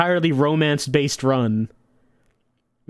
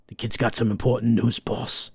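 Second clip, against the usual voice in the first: lower, softer yes-man; lightly voiced henchman; 5 semitones lower, 4 dB quieter than usual. Henchman answering his boss, low and obedient. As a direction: mutter it like an obedient goon.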